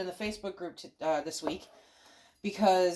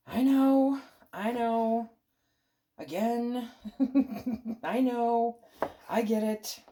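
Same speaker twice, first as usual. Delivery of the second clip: exasperated laughing; 5 semitones higher, 4 dB louder, slower than usual. Pretend. Answering imagined objections.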